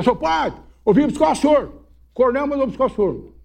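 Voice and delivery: menino caipira. Retold or imitated. Menino chama o padre, voz aguda.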